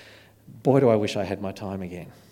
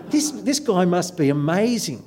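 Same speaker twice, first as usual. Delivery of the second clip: higher, impressed inner exclamation; retold amazement, stumbling into the thought; awed excitement; 7 semitones higher than usual. Retells own growing awe at speaker.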